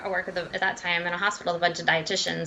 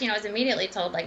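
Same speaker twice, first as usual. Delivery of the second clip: ordinary talk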